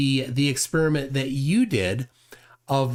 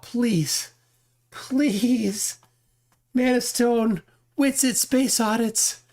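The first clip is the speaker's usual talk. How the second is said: higher, whiny plea, slower at the end; mock begging; 8 semitones higher, much slower than usual. [name] mockingly begging the channel owners for help. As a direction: beg like a helpless kid. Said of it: "he's begging for help".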